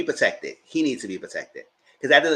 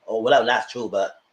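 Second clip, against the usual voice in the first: ordinary talk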